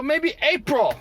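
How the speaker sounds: high mocking broken-English voice; mock worker accent. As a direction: imitate the workers' clipped report. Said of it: "They go".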